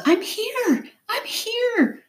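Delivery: contained, high yell to be found. A narrator's reading. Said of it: "I yelled".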